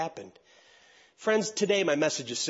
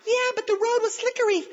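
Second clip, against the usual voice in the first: high mocking woman's protest; indignant woman; 4 dB louder than usual. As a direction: squeal like an outraged driver. Woman arguing with judge.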